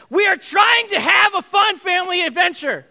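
put-on a dad yelling at whining kids; frustrated shout. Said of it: "you're so frustrated".

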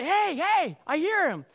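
high, loud retold shout; urgent calling out. Rescuer alerting others to a buried man, urgent shout. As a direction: call the others over urgently.

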